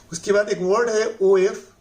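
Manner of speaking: ordinary delivery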